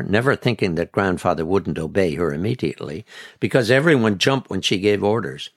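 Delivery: ordinary delivery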